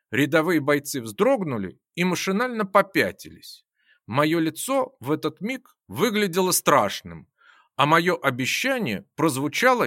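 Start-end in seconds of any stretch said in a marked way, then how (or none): none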